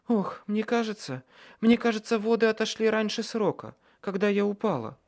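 high, pained woman's voice; стонущая роженица. A narrator's reading. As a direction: speak through labour pains, pitched high. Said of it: "Она застонала". (высоким страдальческим голосом)

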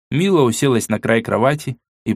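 plain reading voice

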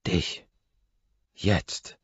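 plain reading voice

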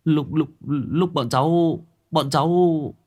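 high, halting stutter; stammering boy. Acted. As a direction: trip over the first words. Stammering boy begins story. (thằng bé lắp bắp kể)

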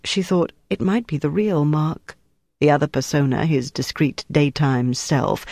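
ordinary delivery

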